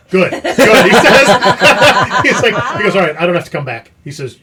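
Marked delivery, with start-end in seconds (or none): 0.1-3.0 laughing. Retold or imitated. Inspector laughing on the phone. 3.0-3.9 laughing. Retold or imitated. Inspector laughing on the phone.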